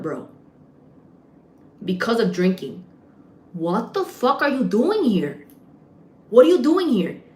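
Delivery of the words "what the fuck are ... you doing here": higher, indignant demand; mad as hell. Retold or imitated. Bartender confronts alcoholic cousin at her bar, mad as hell. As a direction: confront them, stretching the first question, then louder. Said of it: "I got mad as hell".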